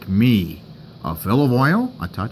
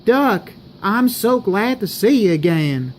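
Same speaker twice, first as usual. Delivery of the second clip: put-on sweet Southern US accent; 5 semitones higher than usual. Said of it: "Why is she talking in a southern accent?".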